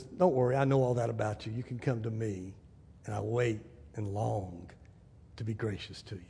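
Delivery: low, hushed, tender voice; gentle reassurance. Acted. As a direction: reassure softly as a patient, gracious Lord. Voices the Lord reassuring a sinner.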